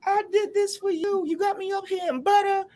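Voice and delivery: high, whiny mock sobbing; crying ex boyfriend. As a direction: imitate him blubbering in a squeaky voice. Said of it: "And he started crying".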